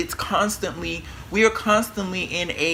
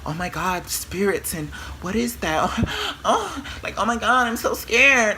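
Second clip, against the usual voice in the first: mock scared; 4 semitones higher than usual. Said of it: "Stop being afraid of it".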